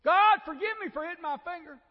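loud, high cry to God over a smashed finger; mock pained prayer. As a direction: yelp the prayer as if the finger really hurts. Mock prayer after hammering finger.

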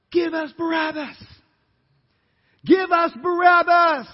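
high, loud chant of a mob demanding a prisoner; crowd yelling. Acted. Crowd screaming back at Pilate, demanding Barabbas, loud mob chant. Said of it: "was yelling and screaming back".

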